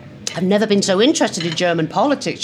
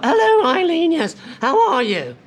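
Theresa May on phone; 10 semitones higher than usual. Retold or imitated. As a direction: answer sleepily polite as Theresa. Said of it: "she's calling Teresa at night".

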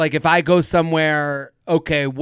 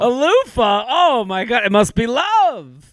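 mock gushing; 7 semitones higher than usual. Co-host teasing the letter writer's loofah gift, mock gushing. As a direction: gush in a high voice as if hugely impressed.

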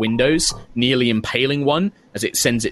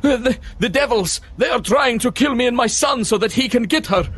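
high, frantic stammering alarm; panicked older man; 12 semitones higher than usual. Acted. Father warning that devils want his son dead, high panicked cry. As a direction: blurt out the danger in terror. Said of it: "and is just like".

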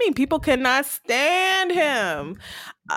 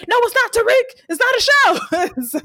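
mock furious fan; 12 semitones higher, 4 dB louder than usual. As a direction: ham up the crazed fan insisting it's real, higher and louder. Fan insisting, mocking retell.

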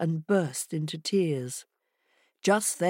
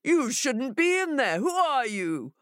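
indignant challenge, pitched up; shouting jackal; 8 semitones higher than usual. Acted. Jackal challenges the voice inside, shouting. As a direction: demand it through a closed door. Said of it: "he shouted".

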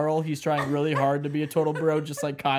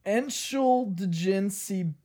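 slow careful German; 4 semitones higher, much slower than usual. Real, not acted. Reading German slowly.

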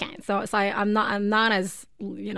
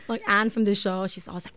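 ordinary delivery

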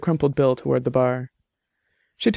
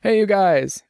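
mild shout; 6 semitones higher, slower than usual. Acted. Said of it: "J T shouted from behind the bar".

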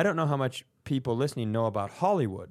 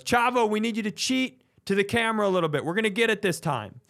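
director notes; 6 semitones higher, faster than usual. Retold or imitated. Director asks Chavo to cheat to camera, higher. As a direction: coax the wrestler like a patient director. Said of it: "he goes".

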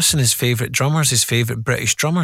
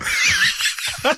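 short high laugh; amused laugh; high breathy laugh. Real, not acted. Laughs at Page's mic question.

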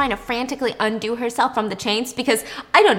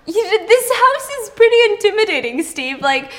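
high, louder, blurting it out to him; straight-up Noah; 6 semitones higher, 6 dB louder than usual. Acted. Noah admits to Steve his house is intimidating, straight up. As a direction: candid and high-pitched, lightly acted. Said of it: "Noah tells him straight up".

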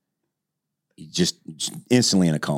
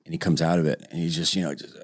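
ordinary talk

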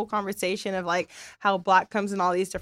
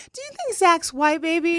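amused gentle question; 8 semitones higher, 4 dB louder than usual. Retold or imitated. Mom gently asks son, laughing.

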